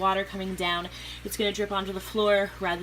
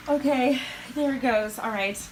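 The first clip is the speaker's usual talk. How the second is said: relieved excitement; 5 semitones higher than usual. Real, not acted. Relief as water flows.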